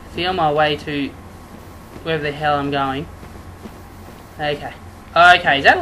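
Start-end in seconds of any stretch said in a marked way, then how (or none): none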